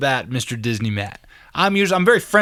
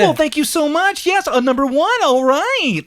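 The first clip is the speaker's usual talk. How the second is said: much higher chirpy customer-service voice; Disney voice; 13 semitones higher, slower than usual. Retold or imitated. Imitating an overeager Chick-fil-A worker, high Disney voice. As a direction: sing it out like a theme-park host. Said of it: "It's the Disney voice".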